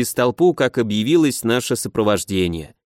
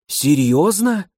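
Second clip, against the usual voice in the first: ordinary delivery, nothing marked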